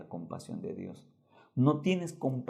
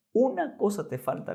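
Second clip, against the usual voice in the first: Jesus's firm call; 11 semitones higher than usual. Acted. Jesus's words, loud and high. (llamado firme y fuerte, en voz alta)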